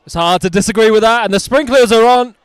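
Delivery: loud, high amused exclamation; excited commentary. Real, not acted. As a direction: exclaim in delighted surprise. Sprinklers come on mid-game.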